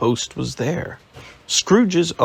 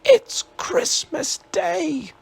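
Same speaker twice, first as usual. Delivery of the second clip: joyous whisper; slower than usual. Acted. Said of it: "whispered Scrooge joyously".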